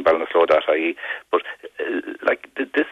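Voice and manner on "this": normal talking voice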